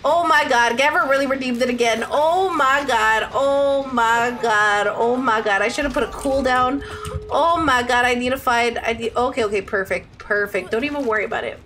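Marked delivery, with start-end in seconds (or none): 2.1-5.6 slow drawn-out dismay. Real, not acted.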